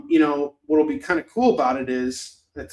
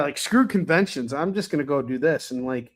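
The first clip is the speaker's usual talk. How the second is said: ordinary talk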